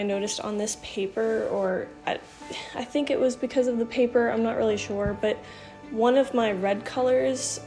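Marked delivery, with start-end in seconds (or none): none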